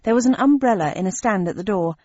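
ordinary delivery, nothing marked

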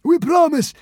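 high, loud pleading consul; frightened promise. Acted. Consul promising the god in fear. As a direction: promise desperately.

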